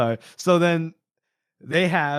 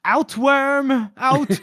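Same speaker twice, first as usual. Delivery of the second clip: high, raised-voice imitation of a command; mock barked dismissal; 11 semitones higher, 4 dB louder, much slower than usual. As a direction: throw the wretch out, hammed up. Retold ordering someone out.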